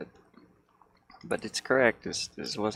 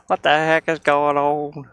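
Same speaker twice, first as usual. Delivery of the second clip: slow, loud, higher exasperated shout; exasperated yell; 6 semitones higher, 12 dB louder, slower than usual. Real, not acted. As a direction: drag it out in loud disbelief. Software glitch frustration.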